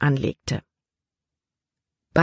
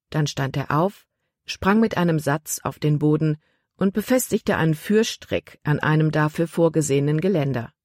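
usual speaking voice

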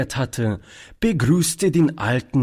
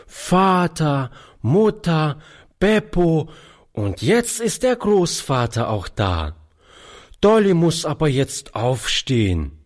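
put-on high child's voice, counting off one by one; small girl listing on her fingers; little girl counting; 6 semitones higher than usual. A narrator's reading. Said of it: "zählte an ihren Fingern".

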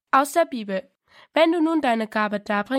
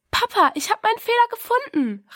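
high, louder joyful cry; excited call; 12 semitones higher, 5 dB louder than usual. A narrator's reading. Diana tells her father she found the mistake, excited call. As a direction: call out the good news to dad. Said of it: "rief sie".

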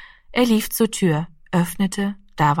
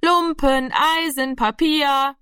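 high, drawn-out rag collector's call; street cry; 7 semitones higher, slower than usual. A narrator's reading. Junk collector calling for rags and scrap, high chanting cry. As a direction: call out your wares in a high sing-out. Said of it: "dazu rufen würde".

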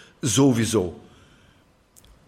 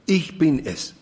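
„Ich“ hervorgehoben, danach fallend; Betonung auf Ich; 2 semitones higher than usual. Shown as a demonstration. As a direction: setz den Nachdruck auf „Ich“. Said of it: "dann liegt die Betonung immer auf ich". (Akzent auf „Ich“, der Rest fällt ab)

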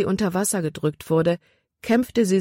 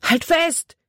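calling out; 12 semitones higher than usual. A narrator's reading. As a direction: shout the order across the torrent. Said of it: "rief er".

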